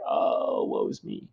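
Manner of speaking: breathy sighed woe; sighing. Retold or imitated. Romeo sighing his woe to the nurse, whispered. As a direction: let it out on a sigh. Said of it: "you know, like, sigh".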